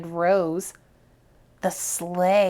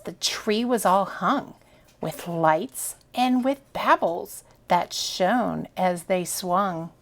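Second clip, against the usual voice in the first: ordinary delivery, nothing marked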